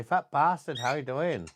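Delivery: high sing-song teaching voice; coaching parrot line. Shown as a demonstration. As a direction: drill it for the bird, "Fat" high.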